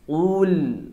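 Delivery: a short, heavy ul from the back of the mouth; thick ul demo. Shown as a demonstration. Teacher models the thick ul for students, heavy and full. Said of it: "Begitu ya".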